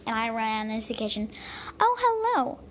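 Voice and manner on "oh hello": "Oh, hello." high and bright as the mom; the mom's sing-song hello; high greeting. Acted. Mom welcomes grandpa in the kitchen, high pitched.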